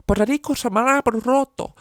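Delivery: mock Spanish telling-off at a dog; Spanish scolding. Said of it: "told it off in Spanish".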